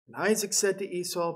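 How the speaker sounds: ordinary talk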